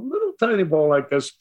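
ordinary talk, nothing marked

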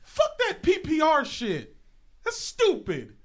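loud, high, indignant dismissal; fiery outburst. Real, not acted. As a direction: shout it down in disgust. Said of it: "Fiery, passionate beginning to the show".

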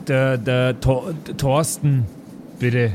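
ordinary talk

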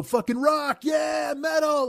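high mock rocker yell; jeering hammed-up rock cheer; mocking metalhead shout. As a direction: taunt them like a fake metal fan. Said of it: "walk up to people and go".

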